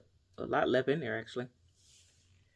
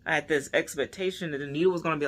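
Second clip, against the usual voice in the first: ordinary delivery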